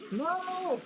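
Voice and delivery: sharp high cry of dismay; shouting. Real, not acted. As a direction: yell it out in frustration. Game death, shouting.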